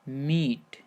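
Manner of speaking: मीट said with a long ee; मीट pronounced "meet", one long vowel. Shown as a demonstration. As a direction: draw out the ee in मीट as a model. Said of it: "double ई से हो गया बड़ी ई की मात्रा".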